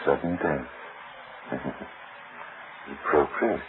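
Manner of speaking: low, hushed and sinister; quiet menace. Acted. Husband toying with his wife over her perfume, hushed menace. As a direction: keep it soft and low with a dark chuckle.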